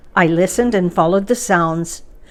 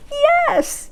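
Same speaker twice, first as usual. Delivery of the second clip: a sudden very high troll yelp; shouting trolls. A narrator's reading. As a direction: fire it off like an eager troll. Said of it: "they shouted".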